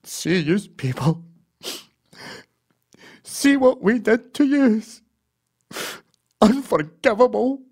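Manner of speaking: mock-weepy guilt, drawn out; tearful Scottish apology. George apologising to a native woman, slow, high and tearful. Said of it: "And he says".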